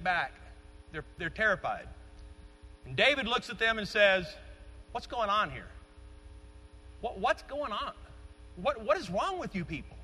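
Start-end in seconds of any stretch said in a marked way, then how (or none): 4.9-10.0 a sharp, disbelieving what's going on; incredulous challenge. Retold or imitated. David confronting his terrified brothers, incredulous. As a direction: challenge the frightened soldiers, half-scolding. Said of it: "I can hear David saying that respectfully".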